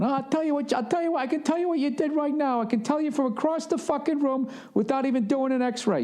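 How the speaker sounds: high, cocky know-it-all doctor; mock doctor voice. Retelling the walk-in clinic doctor, high mocking voice.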